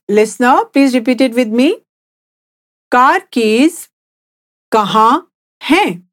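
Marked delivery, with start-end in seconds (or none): 2.9-6.0 slow, split into two parts with a pause; slowly, in chunks. Shown as a demonstration. Teacher repeating the question for the listener, slow and in chunks. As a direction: break it up for a learner to repeat. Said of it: "I'll say it again slowly".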